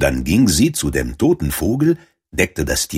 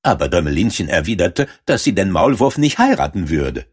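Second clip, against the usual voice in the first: ordinary delivery, nothing marked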